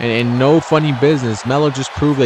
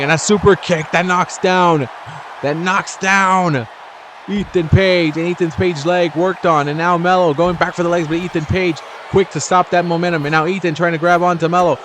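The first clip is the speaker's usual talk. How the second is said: higher-pitched, urgent match call; excited play-by-play; 5 semitones higher than usual. Real, not acted. Commentator follows a super kick and leg work, excited. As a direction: ride the momentum from big kick into running action.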